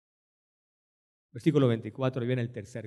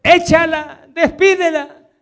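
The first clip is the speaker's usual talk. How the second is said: loud, higher dismissive command; raised scornful repeat of the disciples; disciples' rebuke; 9 semitones higher, 8 dB louder, slower than usual. Retold or imitated. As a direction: shove her away with words. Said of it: "Los discípulos entonces".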